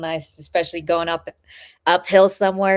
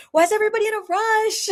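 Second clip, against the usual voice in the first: fast high laughing question; 14 semitones higher, 5 dB louder, much faster than usual. Retold or imitated. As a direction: laugh in disbelief. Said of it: "I was like".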